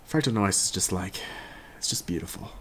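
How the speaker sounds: ordinary talk, nothing marked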